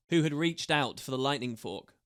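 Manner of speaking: plain reading voice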